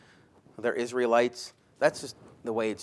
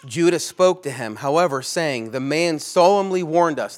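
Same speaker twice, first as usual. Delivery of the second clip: louder, forceful preaching read; scripture reading; 2 semitones higher, 8 dB louder than usual. Acted. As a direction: proclaim the verse to the hall.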